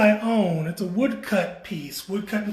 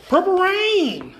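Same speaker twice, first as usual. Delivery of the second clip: high, loud drawn-out yell; imitated shout-out; 12 semitones higher, 6 dB louder, slower than usual. Announcing the last quilt on the floor, imitated yell. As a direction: yell it out, stretching it slowly. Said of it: "saying it like that".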